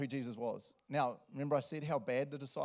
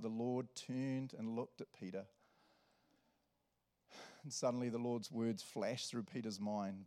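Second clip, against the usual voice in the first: usual speaking voice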